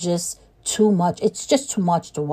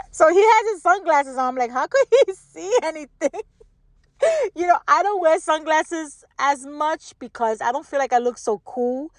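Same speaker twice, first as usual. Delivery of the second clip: laughing; 7 semitones higher than usual. Real, not acted. Laughing about [name]'s sunglasses.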